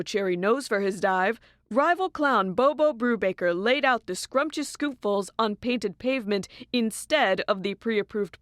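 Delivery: bright TV news read; news anchor. Acted. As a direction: deliver it like a cheery anchor.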